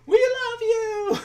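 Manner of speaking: very high, loud, drawn-out mock scream; slow, piercingly high fangirl shriek, hammed-up. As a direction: imitate an adoring fan screaming from the back. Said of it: "this woman just screaming".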